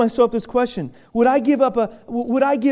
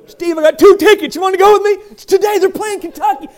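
eager invitation; 8 semitones higher, 7 dB louder than usual. Retold or imitated. Inviting Steve to the game.

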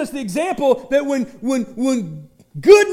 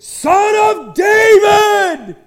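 loud, slow, higher shout of Son of David; enthusiastic cry; 11 semitones higher, 10 dB louder, much slower than usual. Retold or imitated. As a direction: cry it out with everything you have. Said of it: "with even more enthusiasm".

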